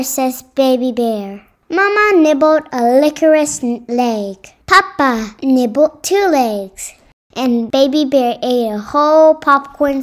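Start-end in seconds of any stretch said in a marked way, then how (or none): none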